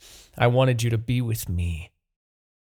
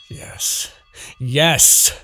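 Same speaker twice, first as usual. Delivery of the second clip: loud exultant yes; triumphant yes; 3 semitones higher, 7 dB louder than usual. Acted. Demon king exulting as the mind control seems to work. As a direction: seize the win.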